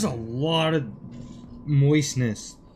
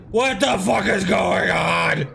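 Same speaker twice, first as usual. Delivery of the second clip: slow, drawn-out frustrated shout; exasperated yell; slower than usual. Real, not acted. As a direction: stretch it into a baffled yell at the game. Frustrated at game fight.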